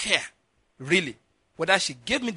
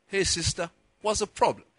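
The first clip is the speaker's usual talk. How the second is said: put-on a light American accent on a friendly question. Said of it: "I'm adding the accent to it now".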